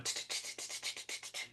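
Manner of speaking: a very soft, toneless run of tongue clicks; imitated oven clicking. Mimicking the lobster noises he heard in the oven, soft clicks. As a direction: click your tongue like something skittering in a hot oven. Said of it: "And I hear inside the oven".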